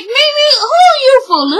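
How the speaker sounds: high-pitched, exasperated challenge; incredulous scolding. Retold or imitated. Viewer scolding Mimi over her empty threat, incredulous. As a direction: call her out, voice shooting way up.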